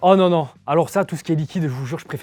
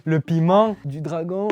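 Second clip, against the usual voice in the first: laughing; 3 semitones higher, 4 dB louder, slower than usual. Real, not acted. Laughing fit after the spicy tea.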